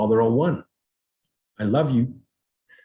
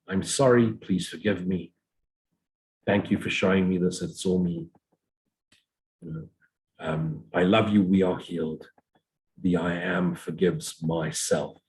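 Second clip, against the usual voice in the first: soft prayer recitation; 2 semitones lower, 3 dB quieter than usual. Acted.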